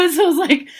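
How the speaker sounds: high laughing burst. Real, not acted. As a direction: laugh through the words. Laughing hard recalling ceremony.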